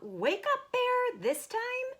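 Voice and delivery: high call to wake Bear. Acted. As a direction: sing it out to a sleeper. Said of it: "Hare called out".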